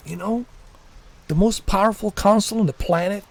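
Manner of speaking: high, whiny mock fanboy voice. Mocking a moderator upset that he left Xbox, high and whiny. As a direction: whine it like a betrayed Xbox fan. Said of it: "they couldn't believe it".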